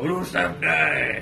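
"Rusev Day" said slowly and clearly, stretched; Rusev Day drawn out. Shown as a demonstration. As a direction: drag out "Rusev Day" word by word. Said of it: "Now go".

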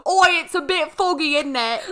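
high, loud put-on Cockney, laughing through it; hammed-up London accent with a laugh; mock British accent. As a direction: play a cheery Londoner, voice up high.

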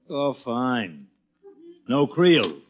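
usual speaking voice

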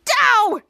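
high, loud pained cry; mock "ow" shout; acted yelp. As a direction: yelp as if shoved over. Retelling character pushed into casket.

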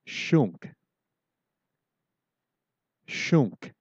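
"shunk" said slowly with a nasal ending, SHOONK; teacher modelling "shunk"; careful pronunciation. Shown as a demonstration. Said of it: "the word for dog is".

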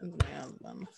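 understated playful contempt; mock disdain. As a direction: drop it with quiet, joking scorn. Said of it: "did you like the disdain in my voice?".

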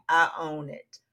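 ordinary delivery

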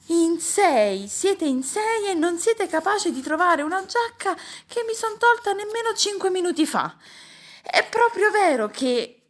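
high, loud indignant tirade; worked-up uncle berating his family; exasperated rant. A narrator's reading. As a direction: scold the whole household at full pitch. Uncle rants over lost jacket.

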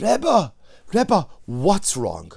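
a loud, alarmed cry of Rebbe, then a slow what's wrong; alarmed call. Acted. Innkeeper bursting into the room calling to the sobbing Rebbe, alarmed.

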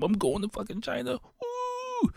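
high, squealing cheer; telling his wife he's off to China, whooping high; ecstatic shout. Retold or imitated. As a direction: burst in celebrating to his wife. Bursting home to tell wife.

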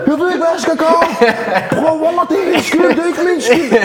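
high-pitched, hammed-up pleading; retold panicked protest. Driver reenacting his excuse to the friend, high panicky protest. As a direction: act out frantic excuses, laughter around it.

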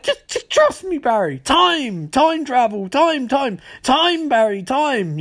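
very high, frantic mock pleading. Harrison begs Barry to trust him, hammed-up frantic. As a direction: swoop down from a high wail on each key word.

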